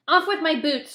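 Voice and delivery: shout. A narrator's reading. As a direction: yell it in a hurry to undress. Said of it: "shouted the centipede".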